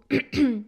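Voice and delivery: loud throat clear. Real, not acted. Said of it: "Pourquoi j'ai la voix cassée?".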